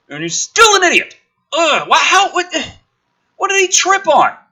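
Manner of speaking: ordinary delivery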